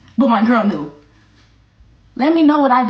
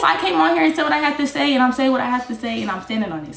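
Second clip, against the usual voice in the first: usual speaking voice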